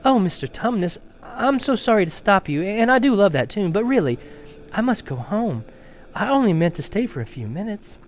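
sorry but needing to leave; apologetic little girl. Acted. Lucy tells the fawn she must go, apologetic.

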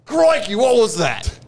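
high-pitched Australian astonishment; startled Aussie. Acted. Australian just hit by repellent, startled. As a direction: blurt it out, hammed-up shock. Reacting to being zapped away.

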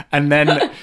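ordinary delivery

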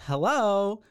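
high rising hello like a phone. Shown as a demonstration. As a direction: chirp it like answering a call. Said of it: "I sounds like the".

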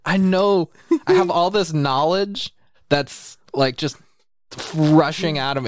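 rushing, loud and high with excitement; gushing with excitement; excited. Real, not acted. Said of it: "You're just so excited".

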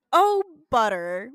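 loud, drawn-out mock wail; mock panic. Fred locked out, a loud mock wail. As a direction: freak out over the locked door, loudly. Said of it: "banging on everything and goes".

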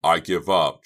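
very loud at a normal pitch; cried out. A narrator's reading. Boy abandoning the cutting, crying out. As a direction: burst out in frustration. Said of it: "Chuck cried".

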